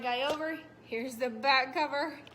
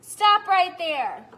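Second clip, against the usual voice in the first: loud, slow shout; 6 semitones higher, 14 dB louder, much slower than usual. Acted. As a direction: bellow it to halt the cow. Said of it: "Shouted the farmer".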